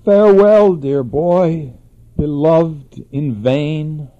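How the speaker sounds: loud, slow farewell, falling away; dying farewell cry. A narrator's reading. Narcissus cries his last farewell to his reflection. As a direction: call out a last farewell with everything left. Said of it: "cried out his last words".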